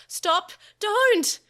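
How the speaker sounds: loud, high panicked yell; child shrieking in alarm; desperate shout. A narrator's reading. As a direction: scream at them to stop. Children crying out to stop fight.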